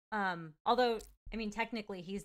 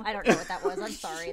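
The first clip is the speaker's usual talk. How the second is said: ordinary talk, nothing marked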